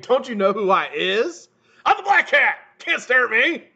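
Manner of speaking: high, loud swaggering boast; mock tough guy. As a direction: puff up and shout like a tough guy.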